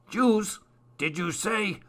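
high, eager question; excited cry. A narrator's reading. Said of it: "cried Larch".

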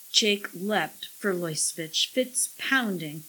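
ordinary delivery, nothing marked

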